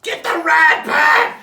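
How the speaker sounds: frantic cheering yell; shouting. Retold or imitated. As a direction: scream encouragement. Screaming at rat.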